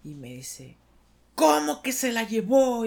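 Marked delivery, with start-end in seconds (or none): 1.3-2.8 loud, high shocked yell from the boss; furious boss shouting at an employee; angry yell. Retold or imitated. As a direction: bellow it in disbelief. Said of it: "Se puso a gritar".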